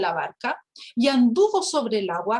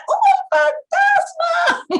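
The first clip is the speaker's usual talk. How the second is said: very high, louder, slow shriek; hammed-up cry of grown fishermen seeing a ghost; terrified scream; 5 dB louder, much slower than usual. Preacher mocking tough fishermen screaming in fright. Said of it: "Que se pongan a gritar".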